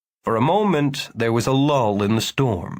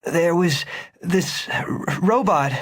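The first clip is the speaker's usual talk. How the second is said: higher, stumbling urgent start; 6 semitones higher, slower than usual. A narrator's reading. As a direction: blurt it out before you can think. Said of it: "surprised by the urgency of his own voice".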